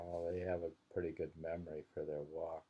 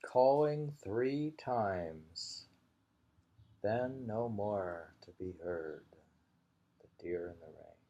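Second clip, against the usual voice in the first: poem recital; 4 dB louder, slower than usual. Acted. Reading the deer haiku to a friend, drawn-out recital. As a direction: draw out calling like a far cry.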